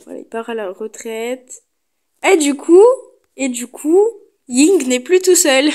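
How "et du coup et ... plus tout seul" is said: excited. Real, not acted. Happy the horse has company.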